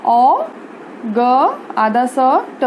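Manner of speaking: ओ, ग, आधा स, ट each said separately; sound by sound. Shown as a demonstration. Teacher showing how to read August, sound by sound. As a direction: break it up with a pause after ओ and ग. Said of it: "पढ़ेंगे कैसे?".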